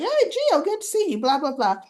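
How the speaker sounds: high-pitched mock-perky hello; Christina's gushing greeting. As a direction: greet in a high, chirpy imitation.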